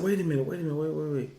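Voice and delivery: high, repetitive mock urgency; urgent retold alert. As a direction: stop her as it dawns on you. Waking wife in alarm, retold.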